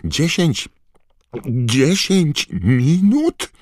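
high, loud, drawn-out panic; panicked disbelief. A narrator's reading. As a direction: shriek it in horror. Harry panicking at the time. (przerażone niedowierzanie)

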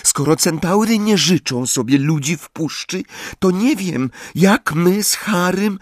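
put-on high, worried girl's voice; breathless girl. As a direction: fret as you hurry along.

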